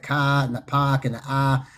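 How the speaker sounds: "ka", "pak" and "ah" with the R dropped; Boston dropped R. Shown as a demonstration. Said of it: "they pronounce their R's different".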